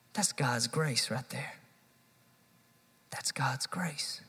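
slow, soft, ending in a whisper; hushed awe. Real, not acted. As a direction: let the wonder sink in quietly. Awed repetition, fading.

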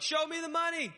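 high, loud movie-quote yell; Jerry Maguire shout. Retold or imitated. Pastor quoting the film line, hammed-up yell. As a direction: belt it like the famous movie line. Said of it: "Cuba Gooding Junior and Jerry Maguire when he's like".

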